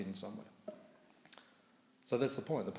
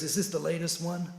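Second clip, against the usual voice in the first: higher and louder, keen question; eager interest; 8 semitones higher, 7 dB louder than usual. Retold or imitated. Monk Navina quizzing the car owner, higher and louder in eager interest. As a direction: sound really taken with the car. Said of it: "he goes".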